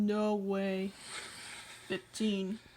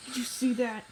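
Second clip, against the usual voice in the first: normal talking voice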